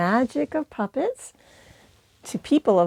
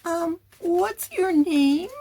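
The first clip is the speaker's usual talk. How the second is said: interviewer voice; 8 semitones higher, slower than usual. Shown as a demonstration. Puppet interview demo.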